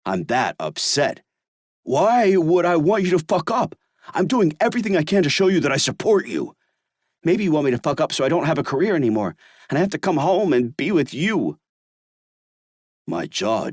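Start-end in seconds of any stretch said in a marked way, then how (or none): 1.8-11.6 loud, high, fast furious retort; furious accusation. Acted. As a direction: hurl the accusation back.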